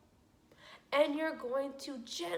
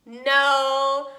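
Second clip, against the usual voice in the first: emphatic no; 12 dB louder than usual. Shown as a demonstration. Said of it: "teacher correcting herself".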